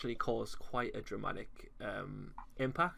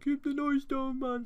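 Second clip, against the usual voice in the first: high, loud parent telling off; annoyed parent voice; 6 dB louder, slower than usual. Retold or imitated. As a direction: scold like a fed-up dad. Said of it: "for them to come in halfway through and be like".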